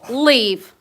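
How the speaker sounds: loud sharp order; stern command. Real, not acted. Owner ordering her dog to leave, loud and stern. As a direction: bark the command at him.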